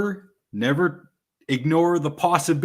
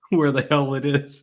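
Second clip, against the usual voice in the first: laughing. Real, not acted. Laughing while searching.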